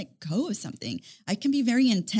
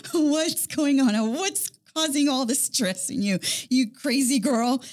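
drawn-out, playfully probing dad; mock-exasperated dad's questioning; teasing dad; 4 semitones higher, 3 dB louder than usual. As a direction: tease it out slowly, as an amused father. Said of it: "they're like".

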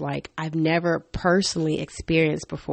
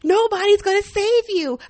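high, loud mock yelling, stretched out; retold yell; 5 dB louder than usual. Fed-up mom yelling back at toddler in church, mock high yell. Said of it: "I yelled at her back".

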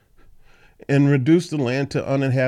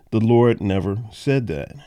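ordinary delivery

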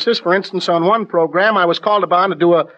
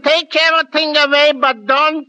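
higher, slow broad Yankee accent; 5 semitones higher, slower than usual. Shown as a demonstration. Actor answers the host in a thick Yankee accent. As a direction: lay the New England twang on thick. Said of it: "my Yankee accent".

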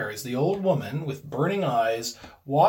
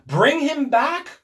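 high, loud, drawn-out demand; desperate cry; 12 semitones higher, 8 dB louder, slower than usual. A narrator's reading. Old woman drags husband to door, desperate cry. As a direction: cry out slowly and commandingly. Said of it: "cried the old woman".

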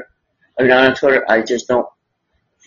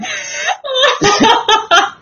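high laugh. Real, not acted. Laughs after joke.

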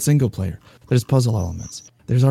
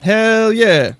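high, loud hell yeah; excited cheer; 12 semitones higher, 7 dB louder than usual. Real, not acted. Cheering the raid plan.

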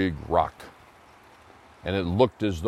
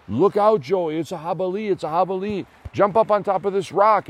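shouted warning, much higher and louder; urgent warning shout; 10 semitones higher, 5 dB louder than usual. Acted. Lumberjack warns Joey of the charging boar, loud high shout. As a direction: yell to save the boy.

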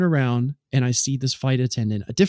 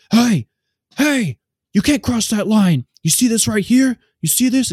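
high, loud mock yell at a passenger; barking the guard's warning in a raised voice; imitating a yell; 9 semitones higher, 5 dB louder than usual. Gate agent yelling at a traveler, loud mock yell. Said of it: "He starts yelling at me".

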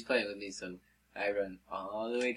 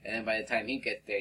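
usual speaking voice

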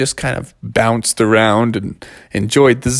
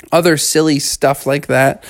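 plain reading voice

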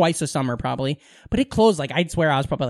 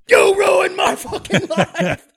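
high, drawn-out mock shout; anguished outburst; 13 semitones higher, much slower than usual. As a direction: wail it at the man who wrecked everything. Husband's outburst at magician, retold.

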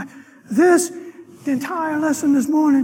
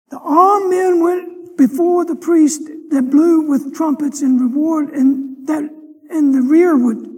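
loud, driving sermon reading of scripture; preacher declaiming scripture with full force; fervent scripture reading; 5 dB louder than usual. Acted. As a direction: proclaim the verses to the congregation. Preacher reads scripture aloud.

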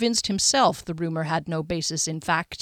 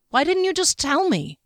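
higher, louder demand; 9 semitones higher, 4 dB louder than usual. A narrator's reading. Kier confronting Derry for keeping silent, demanding. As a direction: push him for an answer. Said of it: "she demanded".

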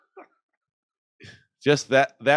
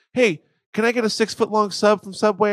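much higher, louder cheery order; chirpy order; 10 semitones higher, 6 dB louder than usual. Acted. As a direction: switch instantly to bright service voice. Ordering over the phone.

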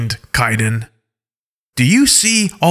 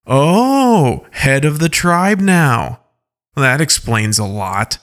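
high, drawn-out mocking Oh; sarcastic; 4 semitones higher, 3 dB louder, slower than usual. Alpha Paw mocking Kaidan's new rank, sarcastically. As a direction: sneer the title with sarcasm. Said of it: "with a very sarcastic tone".

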